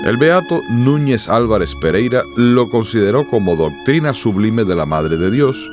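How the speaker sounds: ordinary talk, nothing marked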